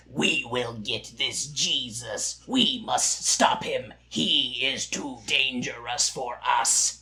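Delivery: a hammed-up Lord of the Rings villain; evil villain voice. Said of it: "talking like an evil villain from The Lord of the Rings".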